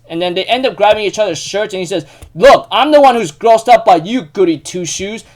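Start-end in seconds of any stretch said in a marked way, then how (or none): 2.3-5.2 acted angry insult. Grabbing each other's shirts in a retold fight, angry insult. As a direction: hurl a mock insult in a heated fight.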